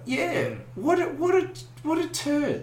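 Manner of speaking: high mock outrage. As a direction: scoff it up high in mock disbelief. Mock outburst about dropped player.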